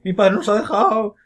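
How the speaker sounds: slow, high whimpering mock lament; hammed-up childish sorrow over a lost dad; mock heartbroken kid. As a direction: whine it like a crushed little boy. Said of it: "se quedó hecho polvo ahí".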